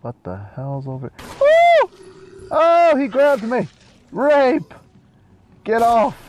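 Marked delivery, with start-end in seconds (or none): 1.4-1.9 very high, loud whoop of fright; startled shriek. Real, not acted. Player jumped by a zombie in game, startled shriek. 2.5-6.2 high, hammed-up cries for help; panicked yelling. Player grabbed by a zombie in game, hammed-up panicked yelling.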